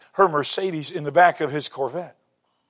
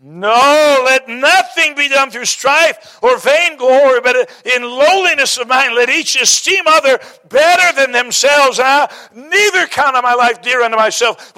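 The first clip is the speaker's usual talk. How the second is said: higher, louder, with slow stretched words; fervent preaching; 8 semitones higher, 6 dB louder than usual. Acted. Preacher quotes Paul to the congregation, fervent. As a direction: preach it out to the whole room.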